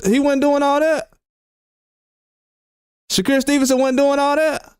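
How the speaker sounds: rising sarcastic "really now?" tone; sarcastic disbelief. Real, not acted. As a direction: needle the fans with fake doubt. Sarcastic disbelief about Shakur running.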